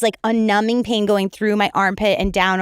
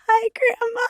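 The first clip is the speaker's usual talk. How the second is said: high, weepy imitation of herself sobbing; tearful little voice; 13 semitones higher than usual. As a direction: squeak it out through retold tears. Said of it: "And I was like".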